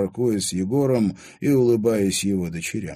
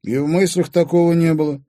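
firm, louder cool denial; cold; 9 semitones higher, 6 dB louder than usual. A narrator's reading. Timofey denying his stepmother's accusation, cold. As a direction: deny it coldly, with raised pitch. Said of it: "холодно произнёс Тимофей".

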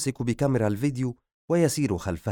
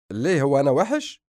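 louder protesting question; objecting; 3 semitones higher, 5 dB louder than usual. A narrator's reading. Hasan answering a jibe about his looks, loud objection. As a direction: protest it loudly, taking mock offence. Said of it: "وقال حسن باعتراض".

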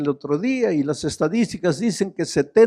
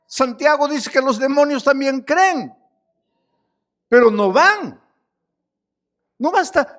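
higher, pressing sermon voice; fervent pulpit preaching; fiery preaching; 8 semitones higher, 4 dB louder than usual. Acted. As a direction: preach it out to the congregation. Preacher rising to full force.

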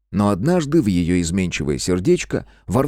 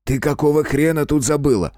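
higher-pitched, annoyed scolding; a touch of angry impatience; irritated bark; 7 semitones higher than usual. A narrator's reading. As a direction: bark at an unwelcome little sister. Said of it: "Рявкает Жека и с раздражением".

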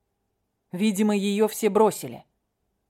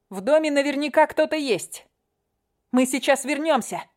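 urgent; 7 semitones higher than usual. Acted. Katya urgent, higher.

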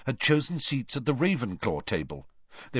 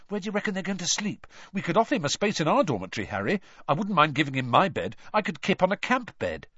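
fast, high eager babble; 7 semitones higher, faster than usual. A narrator's reading. Ron babbling to Harry about giving Krum his bed. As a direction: rush the offers out in hero worship.

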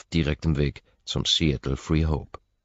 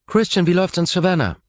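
higher, louder assistant voice; Ross's voice; 7 dB louder than usual. Acted. Assistant Ross greeting her boss on the phone. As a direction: play the cheerful assistant.

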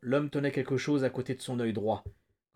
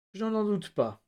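high-pitched, overdone sympathy; forced solicitude; 8 semitones higher than usual. A narrator's reading. Flagg answers the starving prisoner, falsely solicitous. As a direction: feign concern, pitched up high. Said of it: "expression de sollicitude tellement forcée".

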